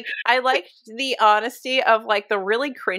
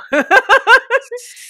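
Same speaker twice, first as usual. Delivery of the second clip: high burst of laughter; cackling laugh at her own joke; loud laugh; 14 semitones higher, 9 dB louder than usual. Real, not acted. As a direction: break into a big loud laugh.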